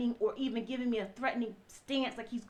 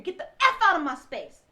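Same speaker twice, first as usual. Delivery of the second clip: loud, higher angry yell; retold screaming; 6 semitones higher, 9 dB louder than usual. Husband screaming at his wife to get out, loud and higher. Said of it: "Now he's screaming".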